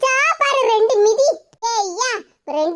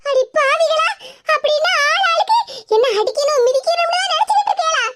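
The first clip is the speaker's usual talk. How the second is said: high, outraged protest; pitched-up indignant wail; shocked outrage; 6 semitones higher than usual. Acted. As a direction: cry out in aghast disbelief. Victim learns others plan beatings.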